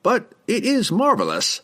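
put-on high eager old wizard, delighted; exclaimed. A narrator's reading. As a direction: gush it like a thrilled scholar.

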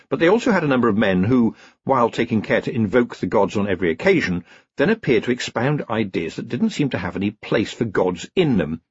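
plain reading voice

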